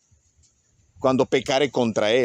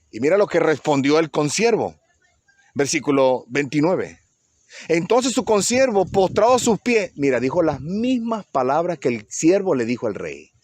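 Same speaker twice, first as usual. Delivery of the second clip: ordinary talk, nothing marked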